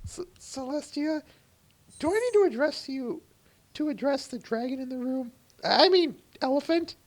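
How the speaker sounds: very high squeaky pony voice; helium voice. Acted. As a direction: squeak like a tiny nervous pony. Plays a pony character.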